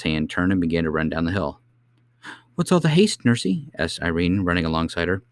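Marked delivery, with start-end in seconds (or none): 2.6-3.7 high-pitched little princess voice; child's high questioning voice; young princess asking. Acted. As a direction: chirp it high, as a curious child. Princess calls out while running.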